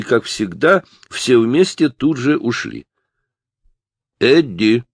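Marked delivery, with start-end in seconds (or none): 4.2-4.8 raised, higher interrupting call; sharp louder summons by name; mother calling out. A narrator's reading. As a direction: call your son from the back of the house. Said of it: "прервал его голос матери".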